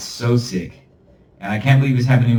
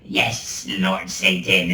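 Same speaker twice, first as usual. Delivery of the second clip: whispered servant demon; 3 semitones lower, 4 dB quieter, much slower than usual. Pretend. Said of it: "a little demon".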